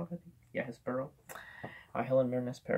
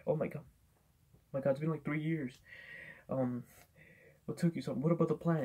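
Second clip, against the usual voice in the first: mock panicked villain; 4 semitones higher than usual. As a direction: play the villain fretting that his partner took years to show up. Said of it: "Doctor Savannah's like".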